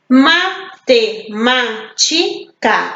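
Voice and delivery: Matemática slowly broken MA, TE, MÁ, TI, CA; slow Matemática in separate beats; syllable by syllable. Shown as a demonstration. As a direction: pause after every syllable of Matemática. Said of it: "quatro sílabas".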